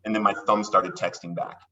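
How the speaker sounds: usual speaking voice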